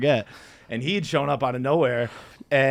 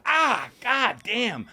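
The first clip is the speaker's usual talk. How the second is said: pained yelp; 10 semitones higher, much slower than usual. Retold or imitated. Pained yelp during spanking.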